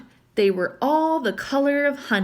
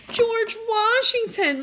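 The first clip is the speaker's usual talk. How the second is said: plain reading voice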